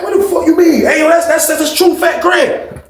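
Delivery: high, excited mock outcry; crowd uproar.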